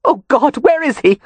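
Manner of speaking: high, loud panicked cry; strangled cry. A narrator's reading. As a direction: cry out in sudden dread. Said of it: "A strangled cry escaped her".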